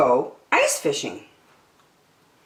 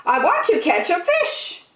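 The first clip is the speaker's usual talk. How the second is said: put-on very high, whiny-eager child voice; impatient little girl. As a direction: squeal it like an impatient kid.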